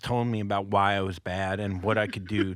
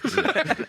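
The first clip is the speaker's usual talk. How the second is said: laughing. Real, not acted. Telling the five hundred dollar story.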